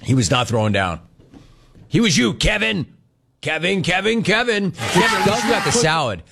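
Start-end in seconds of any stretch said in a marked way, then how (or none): 1.9-6.2 high playful ribbing; teasing. Real, not acted. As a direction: rib Kevin with a grin. Teasing Kevin loudly.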